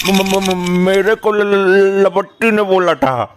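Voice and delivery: high, panicky stutter on first sounds; nervous stammer. Acted. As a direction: stammer out an excuse in fear. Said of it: "तुम्हारी आवाज हकलाने लगती है". (डर से हकलाते हुए)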